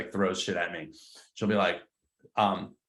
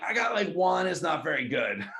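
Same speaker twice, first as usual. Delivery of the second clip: high, sheepish mock agent; a put-on agent voice, half laughing; imitating listing agent; 7 semitones higher than usual. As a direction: play the agent admitting it's weak. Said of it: "sometimes they're like".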